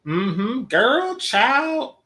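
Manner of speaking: put-on high, loud sassy "Girl, child"; exaggerated sassy auntie imitation; sassy drawl. Mocking the Chitlin accent.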